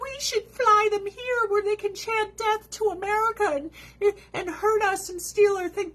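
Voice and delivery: high-pitched naive do-gooder parody; high, mocking imitation; mocking quote. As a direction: mimic them way up high. Mocking what everyone supposedly says.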